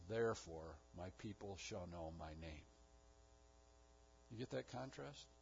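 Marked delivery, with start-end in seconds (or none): 0.1-2.6 low soft declaration. Acted. God's answer, dropped low and soft.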